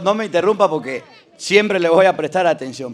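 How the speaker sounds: usual speaking voice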